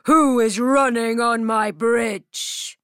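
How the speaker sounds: loud gruff troll voice. Acted. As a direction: boom it out like a troll. Troll speaks in the story.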